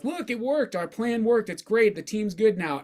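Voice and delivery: high-pitched mock boast of team bosses; self-satisfied team ownership. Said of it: "the ownership will be like".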